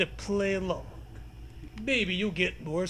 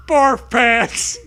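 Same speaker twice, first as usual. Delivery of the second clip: loud goofy yell of barf pants; loud comic outburst; silly shout; 4 semitones higher, 11 dB louder than usual. Real, not acted.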